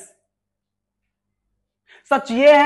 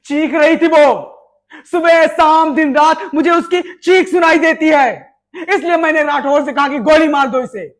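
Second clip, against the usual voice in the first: loud, higher, anguished yelling; furious shout; 4 semitones higher, 5 dB louder than usual. Acted. Officer shouts at the major about the girl's scream, furious. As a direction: let the rage pour out.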